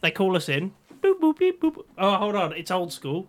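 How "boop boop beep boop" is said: high beeping phone ring; phone ring noise. Pretend. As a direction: chirp it like an old phone. Imitating phone ringing.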